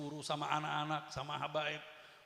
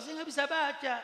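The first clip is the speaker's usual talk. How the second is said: higher, pleading protest; imitated pleading student; 10 semitones higher, 5 dB louder than usual. Student telling Habib Umar he cannot read, mild pleading.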